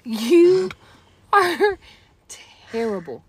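spluttering with a spit take; words spat out, spraying. Real, not acted. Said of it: "I just spit all over you".

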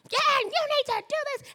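high, screechy mock tirade; hammed-up confrontational yell; mock outburst. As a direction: act out making a big scene, pitch way up. Acts out making a big stink.

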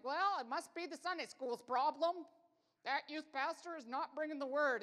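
blame-shifting huff, played up; mocking churchgoers. Pastor imitating churchgoers blaming the youth pastor, loud and indignant. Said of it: "Christians in the chairs are like".

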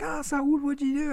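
high, alarmed mom voice; mom's alarmed call. Retold or imitated. Mom calling from her room after glass shatters, high alarmed. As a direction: call out like a startled mother. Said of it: "and she's like".

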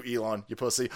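usual speaking voice

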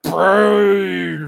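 loud cartoonish yell; mock shout. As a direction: bellow it like the character. Shouting like the character.

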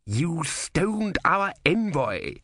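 slow, stern shout of outrage. A narrator's reading. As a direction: hurl the accusation slowly. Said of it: "shouted Vorbis".

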